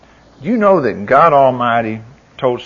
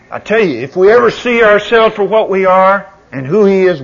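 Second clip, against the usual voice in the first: normal talking voice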